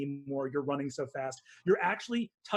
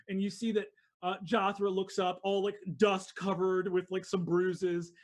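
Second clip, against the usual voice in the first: usual speaking voice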